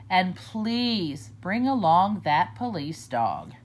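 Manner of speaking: usual speaking voice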